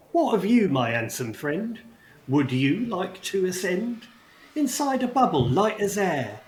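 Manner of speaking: lightly voiced goblin, sing-song invitation; goblin host rhyming. Goblin invites Aster to ride, lightly voiced sing-song. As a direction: coax him up with a playful rhyme.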